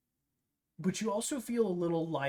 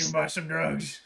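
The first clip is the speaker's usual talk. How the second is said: shady dealer, louder pitch; secretive raspy sales murmur; 6 dB louder than usual. Acted. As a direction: pitch drugs like a back-alley dealer. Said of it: "the shady merchant guy".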